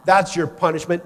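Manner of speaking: loud, mock-stern verdict; acted scolding; angry mother. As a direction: hand down punishment as a cross mom, loudly. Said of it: "She's mad".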